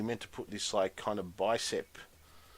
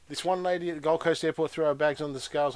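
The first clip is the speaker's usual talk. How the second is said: ordinary talk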